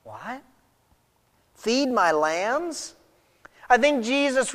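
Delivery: puzzled echo with a rising end; baffled. Acted. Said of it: "Jesus' response initially baffled Peter".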